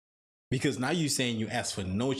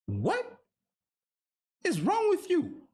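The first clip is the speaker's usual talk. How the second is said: disbelief; slower than usual. Real, not acted. Reacting to shocking clip.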